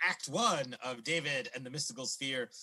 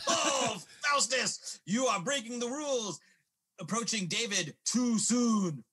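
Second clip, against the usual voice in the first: raised, loud commanding cry; energetic old man; 5 semitones higher, 4 dB louder, slower than usual. Acted. Old man confronting Faustus, energetic and commanding. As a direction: call out the villain grandly, drawing out the end.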